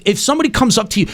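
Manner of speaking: normal talking voice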